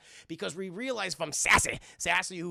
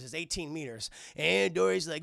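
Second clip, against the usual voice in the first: ordinary talk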